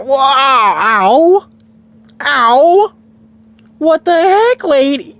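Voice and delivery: high, loud pained yelps; yelping in pain. Acted. Goku hit by the lady's shells, high loud yelps. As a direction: squeal each ow as the shots hit.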